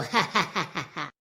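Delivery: low villainous ha-ha-ha; stagey witch laugh; evil cackle. Acted. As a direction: cackle over a wicked plan. Witches plot to become immortal.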